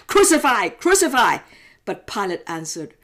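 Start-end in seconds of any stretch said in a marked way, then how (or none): 0.1-1.4 loud, higher repeated shout; crowd shouting. Acted. Chief priests to Pilate, a loud furious shout. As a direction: hurl it out like a baying mob. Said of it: "they shouted".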